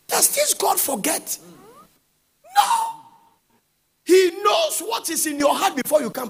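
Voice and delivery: soar up on the question; high chanted preaching over music; preaching climax. Acted. Rhetorical question, climactic answer.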